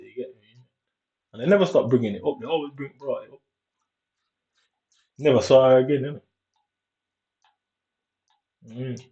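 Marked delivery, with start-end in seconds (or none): none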